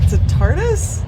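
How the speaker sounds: a tardis, really?; surprised question. Real, not acted. Streamer rereads the clue in disbelief, surprised rising question. Repeats the question, higher and louder.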